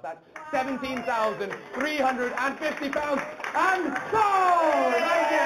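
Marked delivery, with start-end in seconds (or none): 3.5-5.4 high, loud, drawn-out auctioneer's cry; triumphant shout. Real, not acted. Auctioneer closes the final lot, triumphant shout. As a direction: belt it out to close the sale.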